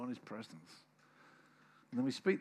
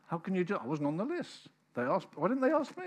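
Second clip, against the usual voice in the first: louder, rising indignant complaint; hammed-up grievance, higher at the end; mock offended rant; 5 semitones higher, 5 dB louder than usual. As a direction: play the hurt member working up. Voicing an offended member rising.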